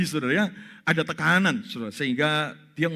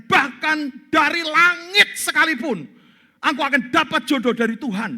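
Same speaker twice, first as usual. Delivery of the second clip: fervent prayer; 10 semitones higher, 8 dB louder than usual. Retold or imitated. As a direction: pray with mounting faith, calling out. (pendeta berdoa berapi-api, keras dan tinggi)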